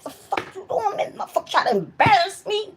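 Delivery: high, squealed mock tirade; spazzing out. Cussing out the ponytail for embarrassing her, high-pitched retold fit. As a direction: act out cussing at the thing that embarrassed you. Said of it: "I started spazzing out on that motherfucker".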